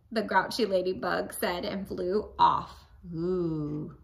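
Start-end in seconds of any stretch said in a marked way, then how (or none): none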